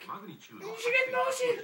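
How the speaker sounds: very high squeaky mock child gibberish; hammed-up girl in fake Japanese; mock little girl. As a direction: squeal it like a tiny panicked kid. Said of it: "she was all like".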